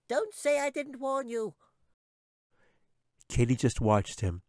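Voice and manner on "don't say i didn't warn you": very high squeak tossed over the shoulder; tiny creature calling back a warning; called-back squeak. A narrator's reading. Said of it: "called back over his shoulder".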